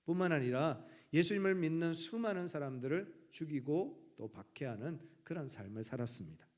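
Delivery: ordinary delivery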